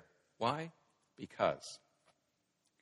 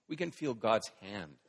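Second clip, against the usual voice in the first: ordinary talk, nothing marked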